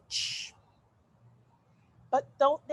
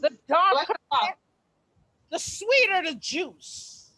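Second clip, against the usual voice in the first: sassy boast; 3 semitones higher, 5 dB louder than usual. Acted.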